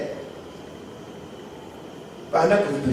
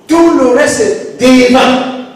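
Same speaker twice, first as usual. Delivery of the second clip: louder, slower, drawn-out declaration; emphatic cry; 5 semitones higher, 7 dB louder, slower than usual. Real, not acted. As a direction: stretch the last words out for weight.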